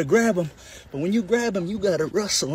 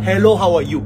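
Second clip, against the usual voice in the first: loud greeting; 10 dB louder than usual. Acted.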